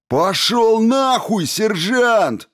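loud, slow, high bellow; roaring shout. A narrator's reading. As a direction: roar it at the cop. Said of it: "заревел хулиган".

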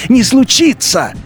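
ordinary delivery

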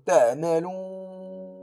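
"تعملون" chanted slowly, the و held; recited with stretched madd. Shown as a demonstration. Teacher demonstrating the pause on تعملون, drawn out. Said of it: "أمدد بالقصر أو التوسط".

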